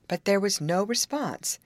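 usual speaking voice

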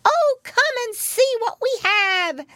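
very high, loud piglet voice; excited kids urging mother along; children shouting. A narrator's reading. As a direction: squeal it eagerly. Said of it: "shouted the children".

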